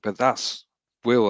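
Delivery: ordinary talk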